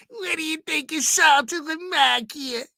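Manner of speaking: high, strained caller voice; Ralph impression. Host replaying Ralph's famous sound bite, pitched high. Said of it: "greatest sound bites of all time".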